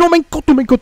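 high shriek of disbelief; shrieking jackpot winner. Real, not acted. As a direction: scream it out, overwhelmed and thrilled. Shock at a near crash.

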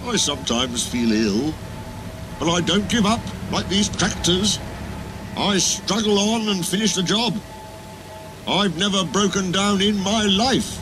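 a hint of boasting pride; proudly. Trevor telling Edward he never breaks down, proud. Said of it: "he ended proudly".

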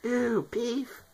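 a very high ew, rising; a helium-pitched ew of disgust; squeaky disgust. Real, not acted. Helium, teasing about beef.

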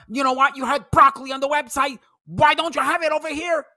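mock Ken lecturing the waitress. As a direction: ham up a smug customer proving her wrong. Mocking Ken proving his point.

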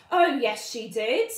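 loud panto call-back; pantomime shout. Acted. Class shouting back at the doubters, loud.

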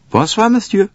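a high, chatty conductor; high character voice. Acted. Conductor recalling being snowed up, high voiced. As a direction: raise the voice for the conductor.

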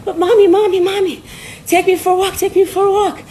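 high-pitched eager dog begging Mommy; high, insistent doggy whine for attention; pleading dog. Acted. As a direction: beg like a pet desperate to go out. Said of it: "But the dog is like".

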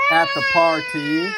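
usual speaking voice

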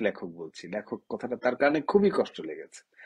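ordinary delivery

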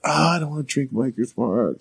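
imitated reluctant child, dragging out the groan; whiny little kid. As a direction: complain like a kid refusing school. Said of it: "a little kid not wanting to go to school".

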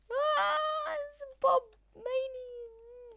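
a high, slow, drawn-out cry of despair; hammed-up high wail over too many Bobs; mock anguished wail. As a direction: wail at the mob of Bobs like it's the end of the world.

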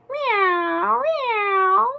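long, high-pitched drawn-out meows; stretched imitation cat cry; meowing cat. A narrator's reading. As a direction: wail it like a cat. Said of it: "said the cat".

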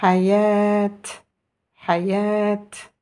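"Hayat." with the last a drawn out before the t; long vowel. Shown as a demonstration. Teacher models a first name, "Hayat." with long last vowel. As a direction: hold the final vowel of "Hayat." evenly. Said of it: "essayons ensemble maintenant de lire les prénoms".

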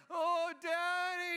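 high, drawn-out mock wail; discombobulated toddler.

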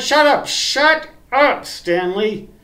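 high, loud, drawn-out shut up; mock self-scolding. [name] cuts off his own rambling story with a mock squealing shout. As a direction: cut yourself off with a comic squeal. Speaker cuts himself off as Stanley.

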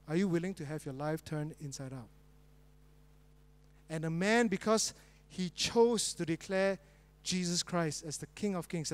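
usual speaking voice

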